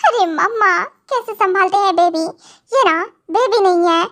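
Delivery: normal talking voice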